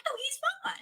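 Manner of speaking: high, soft, lightly voiced reassurance; a touch of airy calm; breezy reassurance. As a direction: brush off the worry lightly. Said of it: "I'm like".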